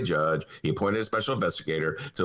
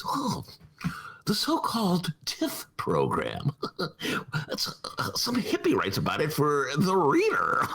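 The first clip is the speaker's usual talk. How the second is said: dismissive mock; 3 semitones higher than usual. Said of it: "the Tribune's attitude is".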